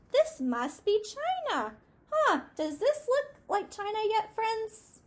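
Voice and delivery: put-on high, teasing mouse voice asking the children; a squeaky mouse checking with the kids; playful mouse. Shown as a demonstration.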